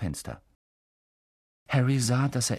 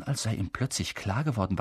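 ordinary delivery, nothing marked

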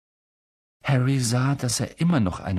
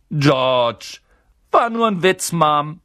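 put-on higher, louder boy voice; cheeky twin; 6 semitones higher, 7 dB louder, slower than usual. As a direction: shrug off the telling-off with a grin. Twin answers the scolding mother.

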